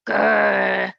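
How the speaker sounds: growly playful dinosaur snarl; a snarling Grrr for little kids; T-Rex snarl. Acted. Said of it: "snarls a T-Rex".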